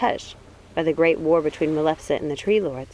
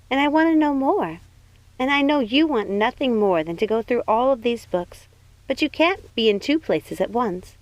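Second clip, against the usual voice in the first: a higher, bright-eyed princess voice; 5 semitones higher than usual. Acted.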